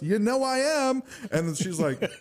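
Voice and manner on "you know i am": high proud reply. Retold or imitated. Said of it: "And he goes".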